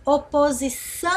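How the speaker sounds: "Oposição" with a long, held final ÇÃO; última sílaba prolongada. Shown as a demonstration. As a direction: stretch out the last syllable of "Oposição". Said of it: "falada como se nós estivéssemos repetindo".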